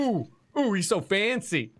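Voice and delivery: high, swooping "ooh" of admiration; mock-impressed cooing. Teasing coo at fancy character.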